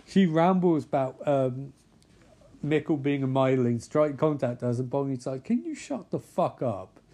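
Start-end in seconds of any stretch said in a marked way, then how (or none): 5.4-7.0 higher-pitched exasperated retort; fed-up character voice, pitched up; acted irritation. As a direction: snap it in mock annoyance. Bonnie's acted angry retort.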